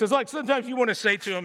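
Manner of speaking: ordinary delivery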